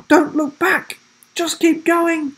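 high, strained call to keep fleeing; urgent urging. A narrator's reading. Sam urges Will away from the hiss behind, urgent high call. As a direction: push him onward in a high voice.

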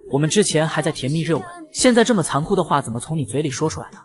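usual speaking voice

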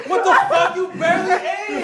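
high laughing disbelief; laughing through pain. Real, not acted. Spicy challenge, laughing in pain.

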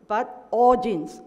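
"all" drawn out slow and loud; stress on "all". Real, not acted. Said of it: "I emphasize here".

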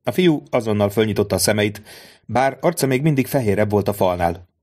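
ordinary delivery

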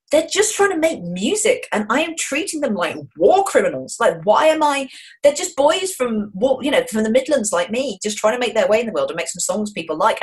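high, emphatic self-reproach; acted dismayed revelation. Critic realising she was cruel to musicians, re-enacted dismay. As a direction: plead the bands' case, pitched well up. Acting out her guilty revelation.

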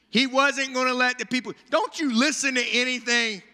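lightly voiced annoyed God; exasperated God. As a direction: scold Moses like a fed-up boss. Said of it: "I would look down and be like".

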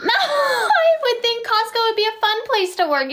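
high, laughing disbelief; incredulous protest. Real, not acted. Host amazed that viewer hates Costco job, incredulous.

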